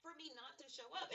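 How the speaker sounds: sharp and high, refusing to be beaten; retold defiance, pitched well up; defiant inner voice. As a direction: snap back at the doubters in your head. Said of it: "but I'm like".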